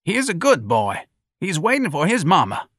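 put-on high-pitched old bystander; old-timer pleading the boy's case; old man protesting. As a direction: defend him in a high voice. Old man character defends the boy.

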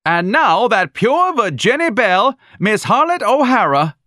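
high, loud, slowed stage announcement; screaming announcer. Acted. Proprietress screaming out the act to the club, high and loud. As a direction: bellow the act to the club like a brash emcee. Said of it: "The Nazi woman was screaming".

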